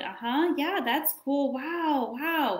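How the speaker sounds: high, offhand uh-huh and wow; half-listening parent faking interest; distracted mock praise. Parent on phone to child, distracted high mock praise. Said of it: "you're scrolling".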